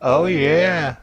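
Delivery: ordinary delivery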